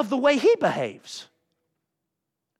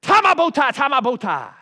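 tongues; 4 semitones higher, 7 dB louder than usual. Retold or imitated. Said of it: "And then on the right, I heard".

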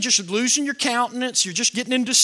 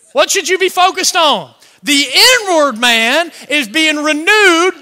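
loud, high-pitched sermon delivery; impassioned pulpit voice; full-force preaching; 7 semitones higher, 10 dB louder than usual. Real, not acted. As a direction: preach it hard to the whole congregation.

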